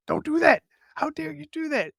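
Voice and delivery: high-pitched, indignant telling-off; squeaky mock outrage; mock scolding. Acts out scolding yourself.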